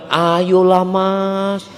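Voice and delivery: drawn-out, lilting coaxing plea; mock a sweet-talking customer, dragging out the vowels; cooing wheedle. Customer haggling with a male seller, cooing wheedle. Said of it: "jangan merayu dengan suara yang mendayu-dayu".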